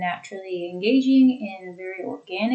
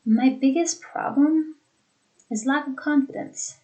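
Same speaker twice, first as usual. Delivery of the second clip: "My biggest problem", brief pause, "is lack of confidence", both pitched up; smooth through "problem", pause, then "is lack of confidence"; two thought groups; 5 semitones higher than usual. Shown as a demonstration. Teacher giving an example to repeat, pausing after "problem". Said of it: "pause very briefly after that thought group".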